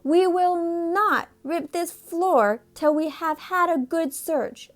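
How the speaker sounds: high, slow, firm announcement; stern father voice. A narrator's reading. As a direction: lay down the law slowly. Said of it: "announced Mister Little".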